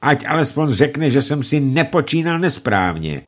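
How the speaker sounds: furious roar. Acted. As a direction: bellow the demand. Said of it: "zařval".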